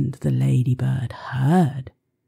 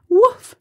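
high loud woof; 7 dB louder than usual. Pretend. Dog sound imitated.

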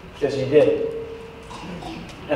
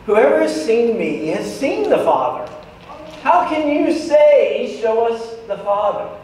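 sighing exasperation; 3 semitones higher than usual. Acted. Jesus answering Philip's request, exasperated. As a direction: stretch it out like an eye roll in words. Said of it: "there's probably a little one of those sighs".

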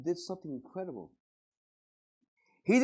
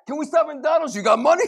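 higher, loud exasperated shout; mock shouting parent; 10 semitones higher, 13 dB louder than usual. Parent shouts at kids asking for McDonald's, hammed-up. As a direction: yell back at the kids.

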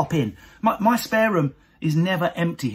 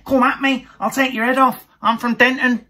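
higher, louder rowdy threat; drunk rowdy Mancunian; 8 semitones higher, 5 dB louder than usual. Retold or imitated. Wife's family after third drink, loud mock threat. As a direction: go from prim to pub brawler. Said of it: "till they've had their third drink".

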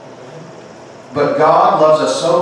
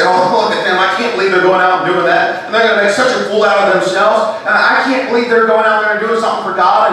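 high, loud scoffing churchgoer; a scornful judgmental bystander; mocking critic; 9 semitones higher, 5 dB louder than usual. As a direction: sneer at the brave ones. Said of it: "you might be here and you say".

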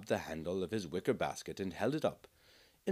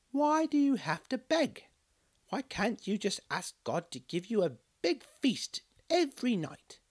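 put-on much higher child's voice pressing; child pushing his point; high insistent little boy; 12 semitones higher, 3 dB louder than usual.

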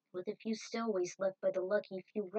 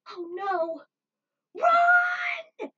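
high, loud terrified cry of run; screaming in panic as the mountain collapses; panicked scream; 10 dB louder, much slower than usual. Acted. Someone caught under the avalanche screams run in panic. Avalanche crashing, character cries out.

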